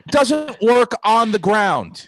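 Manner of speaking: slow, loud, high drawn-out "doesn't"; drawn-out yell. Retold or imitated. Repeats punchline slower for effect.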